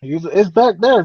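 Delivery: normal talking voice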